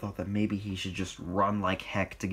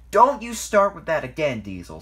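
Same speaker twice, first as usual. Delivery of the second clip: loud, raised-pitch retort; sudden sharp scolding; angry snap; 6 semitones higher, 9 dB louder than usual. A narrator's reading. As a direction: snap back at a troublemaker. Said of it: "snapped Edward".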